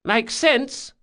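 put-on high, quick boyish reply; young thief voice. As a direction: pipe up agreeing. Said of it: "said Bug".

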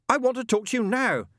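high, frantic insistence; exasperated demand. Acted. Angel demands demon pick up now, exasperated. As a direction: demand an answer, voice pitched right up. Angel shouting at answering machine.

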